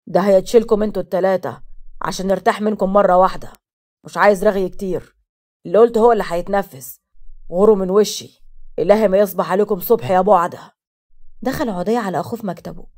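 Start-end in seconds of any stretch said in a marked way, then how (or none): 0.1-10.8 loud, lowered patriarch's rant; harsh dismissive father, raised voice; furious father. A narrator's reading. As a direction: throw them out, loud and stern. Father curses and dismisses his children.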